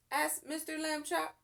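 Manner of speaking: ordinary delivery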